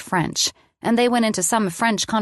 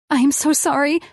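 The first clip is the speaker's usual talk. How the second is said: high, trembling tearful apology; shaking apology; 8 semitones higher, 3 dB louder than usual. Acted. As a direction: apologise on the edge of tears, voice shaking. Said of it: "my body shaking".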